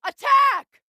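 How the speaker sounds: loud, high shouted order; rallying war cry; battle cry. Acted. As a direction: bark the attack order. Combat callout in battle.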